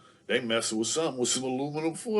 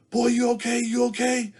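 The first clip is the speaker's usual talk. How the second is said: much higher, louder worried auntie; high, urgent, checking on the boy; 11 semitones higher, 6 dB louder than usual. Retold or imitated. Auntie checking on dazed nephew, high and worried.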